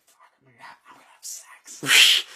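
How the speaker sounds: hushed, breathless whisper of disbelief; excited whisper. Retold or imitated. To himself as she agrees to come to his room, excited whisper. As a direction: whisper it to yourself, barely containing glee. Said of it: "And I was like".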